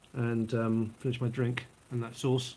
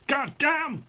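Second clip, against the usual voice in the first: much higher, exaggerated American exclamation; American twang; 12 semitones higher than usual. Shown as a demonstration. Man showing how you can't help it, exaggerated American twang. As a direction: ham up the American twang, pitched way up. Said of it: "an American twang".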